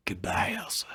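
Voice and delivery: unvoiced, soft whispered goodbye; whispered farewell. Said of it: "He then whispered".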